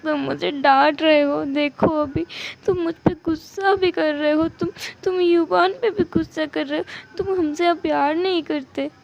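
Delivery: acted sobbing complaint; crying. Spouse crying that husband no longer loves them, tearful. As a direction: wail through play-acted tears. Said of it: "ईबो ज़ोर-ज़ोर से रोने लगा".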